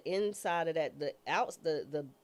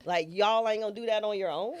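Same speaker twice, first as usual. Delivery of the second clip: incredulous; 5 semitones higher, 6 dB louder than usual. Retold or imitated. Retold indignation at the court.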